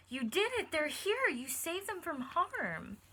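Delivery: high, loud joyful shout; read-aloud zookeeper cheering loudly; excited cheer. A narrator's reading. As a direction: celebrate the rescue.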